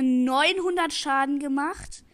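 "neunhundert" drawn out and loud; stress on neunhundert. Shown as a demonstration. Gamer mocking the enemy Colt's damage, slow loud emphasis. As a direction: lean hard on "neunhundert", slowly. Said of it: "ich betone".

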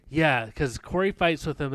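normal talking voice

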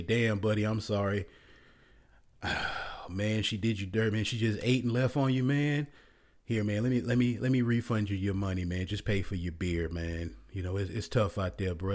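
low, soft sympathetic buddy voice; hushed mock condolence from a manager; consoling manager. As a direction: comfort the sad guy man to man. Manager consoles jilted guy.

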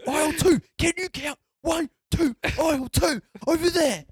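loud, high shouted directions; mock condescending yell. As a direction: yell as if at a clueless customer. Acted worker yelling directions.

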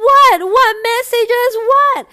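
very high, loud frantic wailing; mock crying. Imitating Mister C asking about the messages, mock crying. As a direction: imitate panicked bawled questions. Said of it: "crying out loud".

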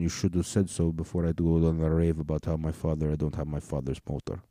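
put-on subdued Russian accent. Russian scolding listeners who don't speak the language, Russian accent. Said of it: "if you don't speak Russian".

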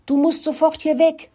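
high urgent mouse call. A narrator's reading. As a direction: call out to a friend in danger. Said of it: "rief Murkel".

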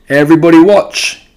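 loud, high call for attention; excited cry. Acted. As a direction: shout it out, bursting with hope. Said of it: "he cried".

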